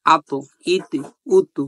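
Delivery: আত, ইত, উত with the final ত bounced out hard; qalqala bounce. Shown as a demonstration. Teacher demonstrating the qalqala bounce on ত. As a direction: jolt the closing ত on আত, ইত, উত. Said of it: "ধাক্কা দেওয়ার চেষ্টা করব".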